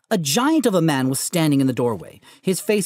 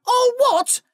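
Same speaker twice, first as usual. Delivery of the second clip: very high, loud thundered All what?; 3 dB louder than usual. Acted. Giant thundering All what? at Harry. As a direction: roar All what? back in outrage. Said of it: "Hagrid thundered".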